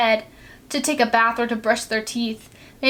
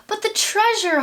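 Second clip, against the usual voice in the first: holler; 10 semitones higher than usual. Acted. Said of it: "hollered Braidbeard".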